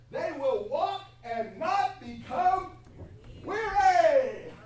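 slow, building to a roar on weary; full-force pulpit crescendo; fiery preaching. Acted. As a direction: preach it up to a climax.

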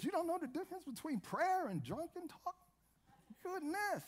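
high, hushed mock disbelief; scandalized churchgoer. Preacher acting shock at people coming in drunk, high and scandalized.

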